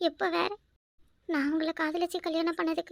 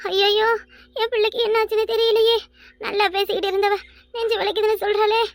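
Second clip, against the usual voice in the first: higher, louder anguished lament; panicked wail; 4 semitones higher, 6 dB louder than usual. Acted. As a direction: wail in panic for your child. Mother panics over collapsing daughter. (பதறிய புலம்பல்)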